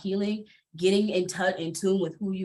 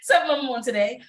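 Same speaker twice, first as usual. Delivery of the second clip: high, quick playfully coy answer; playful reply; 8 semitones higher, 6 dB louder than usual. Retold or imitated. Answering her teasing clients, high and playfully coy. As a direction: act sheepish and playful. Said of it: "And I'm like".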